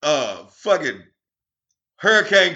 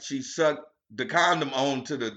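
ordinary delivery

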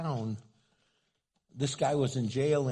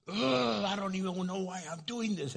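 high whiny imitated defeat; mock frustrated groan; 8 semitones higher than usual. Gunman giving up the gun, whiny mock defeat. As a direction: throw up your hands with an ugh.

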